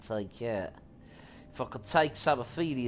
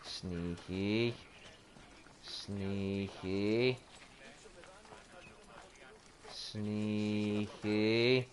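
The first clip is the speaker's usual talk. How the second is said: low, soft, rising sneaky; sneaky sing-song; 6 semitones lower, 7 dB quieter, much slower than usual. Pretend. Creeping up on bandits, playful sneaky murmur. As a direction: tiptoe the word playfully.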